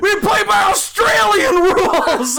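high, loud, drawn-out shout; imitated yelling. The other guy countering the Chicago rule, imitated yell. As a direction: yell it back, stretching the words. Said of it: "the other guy is yelling".

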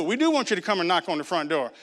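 ordinary delivery

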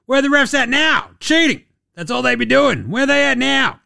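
mock coach rant. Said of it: "Ty Lue also having a crack at the refs".

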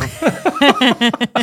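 high, loud burst of laughter; real high-pitched laughing fit. Real, not acted. Laughs at his own story.

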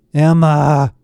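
loud call through the door; yelling. A narrator's reading. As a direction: yell the name out, drawn out. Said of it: "Barrett yelled".

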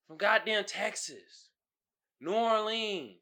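ordinary delivery

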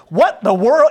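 high, loud burst of surprise; acted startled exclamation; mock astonishment. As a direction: play up being utterly startled. Mimics surprise Balaam lacks.

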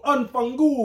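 high, loud hammed-up Italian curse; mock Italian swear. Joking about Italian slang with his cohost, mock Italian accent. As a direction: spit out Unfangul like a cartoon Italian.

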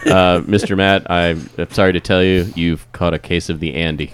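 low, soft bad-news doctor voice; a hint of a somber doctor; grave doctor. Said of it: "The doctor sits Matt down at the office".

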